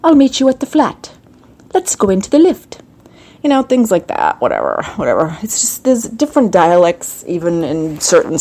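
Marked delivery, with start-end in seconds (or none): 0.0-2.8 succinct Queen's English, mock British; Queen's English accent. Shown as a demonstration. Host contrasting it with Cockney, Queen's English accent. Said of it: "you've got the Queen's English".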